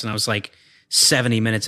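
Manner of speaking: normal talking voice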